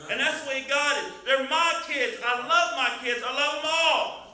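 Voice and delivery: full-force preaching. Real, not acted. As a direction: build to shouted praise.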